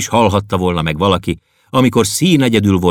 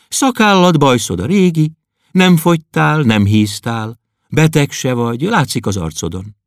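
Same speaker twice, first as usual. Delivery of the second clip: put-on girl's voice; 7 semitones higher than usual. Klopédia inspects him.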